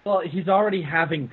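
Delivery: normal talking voice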